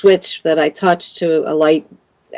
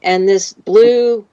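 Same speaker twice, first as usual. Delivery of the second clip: ordinary talk, nothing marked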